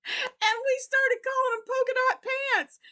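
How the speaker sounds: high, squealed through laughter; laughing hard. Real, not acted. Vlogger telling viewers her friend's funny story, laughing hard. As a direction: burst out with it, overcome with amusement. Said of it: "I'm laughing so hard".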